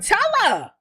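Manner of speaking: high-pitched, insistent mimicked urging; retold urging. Dream mother urging her son to confess, insistent. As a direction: urge it with a high, falling pitch.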